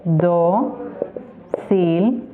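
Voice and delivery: "Dócilmente" very slowly, long pause after each syllable; Silbentrennung. Shown as a demonstration. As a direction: sound out "Dócilmente" as do... cil... men... te. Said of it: "lo vamos a separar".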